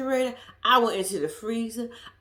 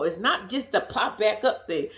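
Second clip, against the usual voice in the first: normal talking voice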